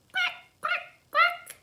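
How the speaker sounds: very high, loud squeaky quacks; tiny baby ducks peeping quack; duckling quacks. Acted. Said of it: "says the ducklings".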